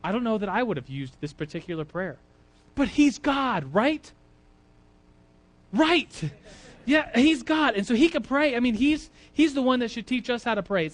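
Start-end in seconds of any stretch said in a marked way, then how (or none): 2.7-10.9 higher and louder, building conviction; excited emphasis. Real, not acted. Preacher insisting to the congregation that Jesus is God, excitedly. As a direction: drive home that he's God. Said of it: "preacher's emphatic point".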